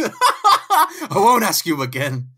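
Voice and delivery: loud, high, slow threat through laughter; hammed-up threat. Kidnapper threatening Jameson about the seatbelt, slow loud mock menace. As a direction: draw it out with mock menace, cracking up.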